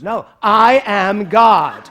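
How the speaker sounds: slow, loud and sure; a child announcing I am God, loud and slow; boy's proud declaration. Retold or imitated. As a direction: declare it like a bold little boy. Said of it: "He goes".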